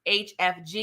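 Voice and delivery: ordinary talk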